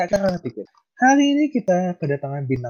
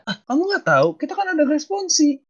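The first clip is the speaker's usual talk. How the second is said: shocked disbelief; 11 semitones higher, 6 dB louder than usual. Acted. Acted skit, classmate shocked.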